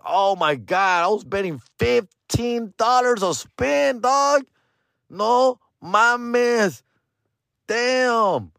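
drawn-out, high-pitched dismay; hammed-up high groan of disbelief; dismayed realization. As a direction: replay the horrified realization. Realizing his costly mistake.

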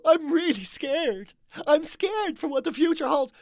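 hammed-up worried lefty; mocking scared liberal. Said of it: "It's all".

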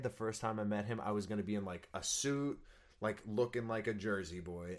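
ordinary talk, nothing marked

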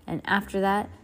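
ordinary delivery, nothing marked

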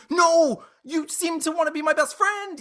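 high-pitched mock-desperate cry; dramatic plea. Player pleads with the fading Haviland, in mock desperation. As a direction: wail it way up high, hammed-up.

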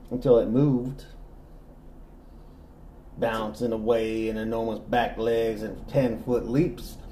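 grand Southern gentleman storyteller; southern accent. Pretend. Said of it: "I'm the one doing the voice".

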